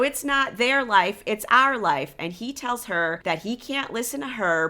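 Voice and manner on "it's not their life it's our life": mock yell, higher and louder; a hammed-up angry husband's yell; retold yelling. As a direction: imitate him shouting it back at her. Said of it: "And he's yelling that".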